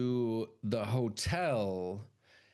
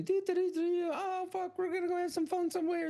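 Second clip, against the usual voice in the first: put-on much higher, airy carefree girl humming along; girly singsong voice. Young women driving to have fun, high girly singsong.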